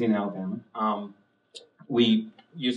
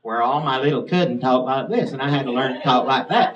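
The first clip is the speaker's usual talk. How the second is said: louder Alabama country-cousin accent; Southern accent; 5 dB louder than usual. Shown as a demonstration. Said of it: "talk like this".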